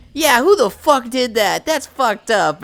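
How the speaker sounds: hammed-up righteous indignation; mock indignation. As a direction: join in the outrage as if innocent. Plays forgetful prankster joining the outrage.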